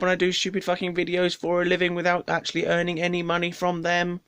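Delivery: high, sustained self-mocking whine; pestering mum in a high, droning mock complaint; mock whining rant. Voicing own whiny complaint to mum.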